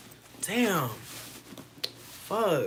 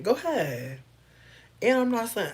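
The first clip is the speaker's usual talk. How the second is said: ordinary talk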